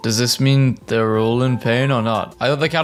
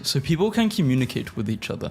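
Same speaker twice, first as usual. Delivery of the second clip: ordinary delivery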